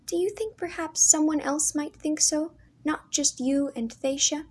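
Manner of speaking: put-on a young girl hoping to be told she is pretty; voice of young sister Leah. A narrator's reading.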